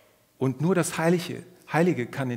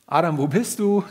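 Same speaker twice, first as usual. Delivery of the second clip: mock calling out; 3 semitones higher, 7 dB louder than usual. Mocking God searching behind tree.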